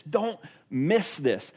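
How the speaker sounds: slow, pressing Don't miss this; slow urgent plea. Acted. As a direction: lean in and drag it out. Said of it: "he wants them to get this".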